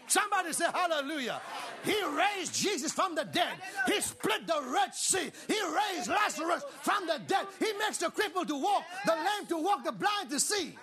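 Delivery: rousing, rhythmic pulpit shout; fired-up preacher; full-force preaching. Real, not acted. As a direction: build a list of miracles with punched stresses.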